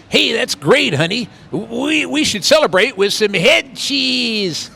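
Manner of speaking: a hammed-up hearty husband, slowing at the end; mock hearty husband. Man answers her in the film.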